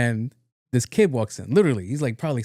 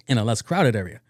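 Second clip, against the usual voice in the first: ordinary delivery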